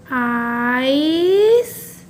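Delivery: आइस with the vowel stretched very long; very slow teaching model of आइस; slow read-along. Shown as a demonstration. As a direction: draw out आइस for pupils to join in. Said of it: "फिर से एक बार साथ में पढ़िए".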